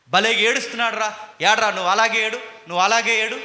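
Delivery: mocking God. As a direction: jeer at the crying man like a cruel god. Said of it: "అనుకుంటాడా?".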